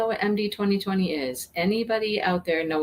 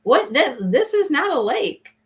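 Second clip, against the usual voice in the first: lightly imitated incredulous man, pitched higher; mock disbelief; 7 semitones higher, 4 dB louder, slower than usual. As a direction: retell his baffled reaction, higher and drawn out. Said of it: "the one guy's like".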